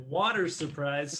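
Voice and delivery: "water surprise" drawn out slowly. Shown as a demonstration.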